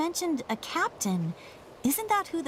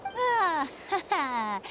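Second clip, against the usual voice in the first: teasing laugh; 4 semitones higher, much slower than usual. Acted. Teasing friend after winning argument.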